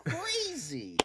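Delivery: very high, slow, swooping wonder; exaggerated mock amazement. Co-host comparing it with the Supergirl trailer, hammed-up amazement. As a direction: draw it out in hammed-up astonishment.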